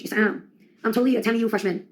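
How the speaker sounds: usual speaking voice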